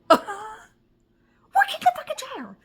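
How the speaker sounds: high, slow, laughing through it; laugh-cry like a crazy person; crazed laughing insistence. Retold or imitated. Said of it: "I was like a crazy person".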